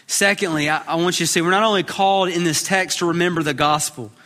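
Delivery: usual speaking voice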